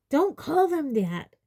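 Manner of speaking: put-on high, furious girl's protest. A narrator's reading. Hermione rebuking Umbridge, furious high cry. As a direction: snap it out, pitched high and falling. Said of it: "Hermione said furiously".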